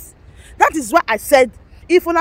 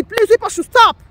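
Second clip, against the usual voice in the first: getting angry; 8 semitones higher, faster than usual. Real, not acted. Telling agitators to stop, high and angry. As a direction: pitch it up sharply as anger rises. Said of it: "I'm getting angry already".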